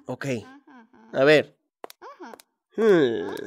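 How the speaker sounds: ordinary talk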